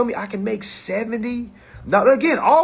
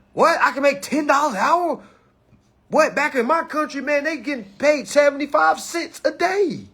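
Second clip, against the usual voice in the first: higher, lightly voiced incredulous worker; imitating amazed worker; 8 semitones higher than usual. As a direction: play a newcomer stunned by the pay.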